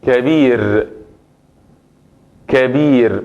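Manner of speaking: slow demo of kabir, final r with sukun; Arabic word kabir. Shown as a demonstration. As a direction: say kabir, end on a plain r. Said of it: "digo kabir y pongo sukun". (pronunciación árabe de kabir, r final sin vocal)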